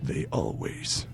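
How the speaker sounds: hushed and menacing, letting it linger; creepy whisper. Retold or imitated. Texting back the scam bot, a creepy parting whisper.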